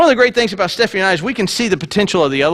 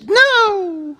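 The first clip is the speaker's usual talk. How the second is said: high, whiny falsetto wife refusing; wife's high protest; 14 semitones higher than usual. Retold or imitated. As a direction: squeak out her refusals in a high mock voice.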